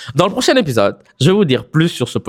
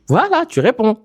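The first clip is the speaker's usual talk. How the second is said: pleased; 11 semitones higher than usual. Pretend. Pretend praise of listener reply.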